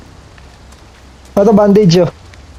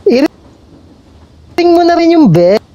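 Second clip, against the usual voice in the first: YouTuber outro voice; 8 semitones higher, much slower than usual. Pretend.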